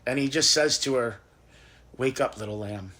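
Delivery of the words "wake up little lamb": low, soft, gentle waking voice; tender. Acted. Jesus wakes the little girl, tender and hushed. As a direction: say it softly and low, as to a sleeping child.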